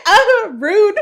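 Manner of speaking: loud, high laughing indignation; squealed mock outrage; laughing offence. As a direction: act offended through a big laugh. Retold offended reaction, amid laughing.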